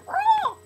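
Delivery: very high squawked "roar"; owl screech. Acted. Announcing the owl arrived, bird screech. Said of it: "The owl did arrive".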